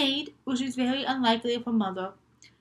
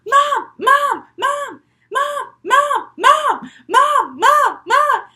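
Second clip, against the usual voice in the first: high, loud calls on repeat; insistent, high-pitched shout; yelling for Mom; 11 semitones higher, 10 dB louder, much slower than usual. Acted. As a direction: holler for your mother like a broken record. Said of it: "and she yells".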